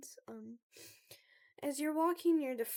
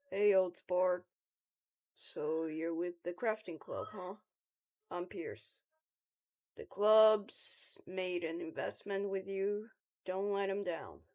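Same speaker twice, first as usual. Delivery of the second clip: low-voiced club veteran; low, measured old-sport voice; Pierce; 4 semitones lower, 5 dB louder than usual. Older club member advising the new recruit, low and measured.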